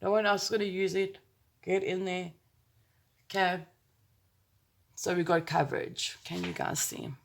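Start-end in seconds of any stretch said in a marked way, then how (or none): none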